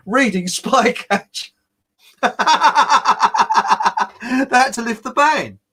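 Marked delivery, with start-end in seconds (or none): 2.2-4.4 loud high burst of laughter; real rising laugh; hearty laugh. Real, not acted. Big laugh at ban story. 4.5-5.6 laughing. Real, not acted. Laughing while telling ban story.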